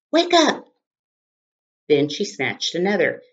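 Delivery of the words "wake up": high, loud yell. Acted. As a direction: yell it at the leaf. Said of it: "grabbed one leaf and yelled".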